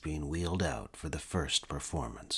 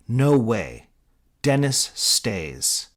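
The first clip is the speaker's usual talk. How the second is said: loud, slow and stubborn; firm refusal; 5 semitones higher, 8 dB louder, much slower than usual. Acted. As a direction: plant your feet, refuse. Mitch refusing firmly.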